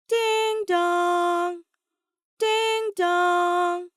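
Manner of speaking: high, loud, long sung ding-dong; doorbell chime. A narrator's reading. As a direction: ring out like a doorbell. Narrator voices the doorbell chime.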